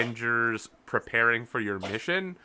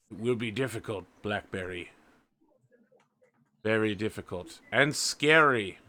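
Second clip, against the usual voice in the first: grave elder warning; 2 semitones lower, slower than usual. Acted. Gray elder warns Blackberry.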